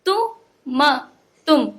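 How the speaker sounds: "तु", "म" apart, then "तुम" whole; broken, then joined. Shown as a demonstration. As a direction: sound out "तु" and "म", then blend to "तुम". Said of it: "तोड़ तोड़ के पढ़ना".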